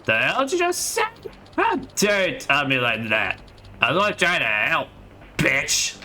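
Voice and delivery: offended punk turning nasty; angry thug. Acted. Thug insulting Reina after rejection, outraged then sneering. Streamer voicing street thug.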